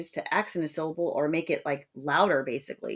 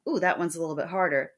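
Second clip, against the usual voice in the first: normal talking voice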